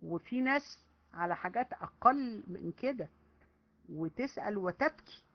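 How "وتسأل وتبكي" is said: ordinary talk, nothing marked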